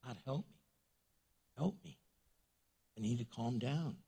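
soft, low muttered plea; calming-down prayer. Acted. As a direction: quietly pray for self-control after a deep breath. Acts praying to calm down.

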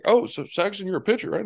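high, sugary imitation of an old donor; high mock donor voice. Mocking donors' questions.

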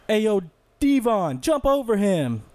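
put-on older brother calling out. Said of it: "one of his older brothers was like".

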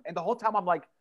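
normal talking voice

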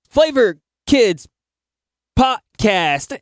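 high, slow with weird pauses; fumbling announcer read, pausing mid-phrase; stumbling intro. Retold or imitated. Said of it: "I'm, like, stumbling over everything".

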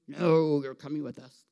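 booming, stretched-out demon voice. Retold or imitated. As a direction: play a demon refusing to let him go. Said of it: "'cause they were demons".

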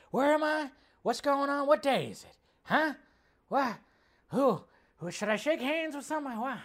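high, lost, bewildered put-on voice; confused old man.

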